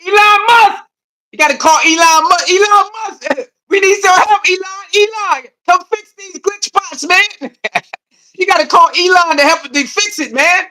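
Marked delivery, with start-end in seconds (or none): 0.0-5.8 calling out. Real, not acted. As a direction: shout his name across the room. Calling out for Elon.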